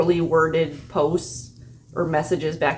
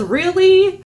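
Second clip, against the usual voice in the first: high, loud "Really?" of mock amazement; mock surprise; 12 semitones higher, 7 dB louder than usual. Scammer reacting to her chicken talk, mock surprised. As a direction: toss out a hammed-up, disbelieving "Really?". Scammer replying in a text exchange.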